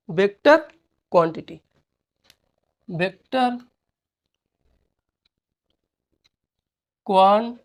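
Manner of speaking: Vector and quantity said apart with long pauses; dictating word by word so students can write. Shown as a demonstration. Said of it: "teacher dictating word by word".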